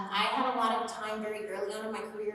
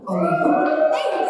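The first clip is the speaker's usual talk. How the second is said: yelling; 11 dB louder, much slower than usual. Acted. In Mereoleona's yelling voice.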